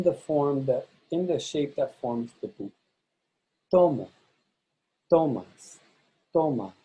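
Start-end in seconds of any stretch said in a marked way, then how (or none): 3.7-6.7 TO punched in "Tomo, tomas, toma", endings falling away; stress on stem. Shown as a demonstration. Teacher models boot forms, TO stressed in "Tomo, tomas, toma". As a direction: hit TO each time in "Tomo, tomas, toma". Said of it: "stress the stem of the verb".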